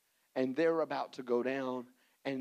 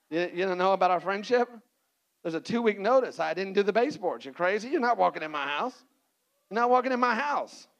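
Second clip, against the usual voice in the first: indignant refusal; 5 semitones higher, 6 dB louder than usual. Acted. Host refusing visitors.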